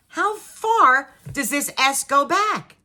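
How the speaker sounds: loud, high, drawn-out question; retold exasperation. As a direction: stretch each word in mock dismay.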